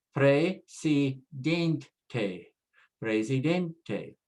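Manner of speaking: presidente as preh-see-DEN-teh, weight on DEN; syllable-by-syllable presidente, DEN strongest; stress next-to-last. Shown as a demonstration. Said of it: "stress on the second to the last".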